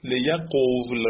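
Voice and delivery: slow لیقول as le-ya-qovl; لیقول drawn out, ending in v and l together; middle form liyaqovl. Shown as a demonstration.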